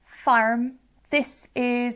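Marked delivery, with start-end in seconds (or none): 0.0-0.7 "farm" with the r sounded; louder "farm" with a clear rhotic r. Shown as a demonstration. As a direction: say "farm" as farrm, West Country style. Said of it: "you've got that R there".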